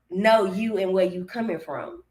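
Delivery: ordinary talk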